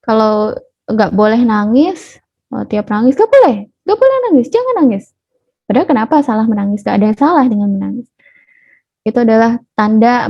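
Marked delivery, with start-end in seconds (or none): 3.1-5.1 high, quick acted scolding; parent forbidding. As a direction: rap out a prohibition to a crying child. (larangan cepat bernada tinggi)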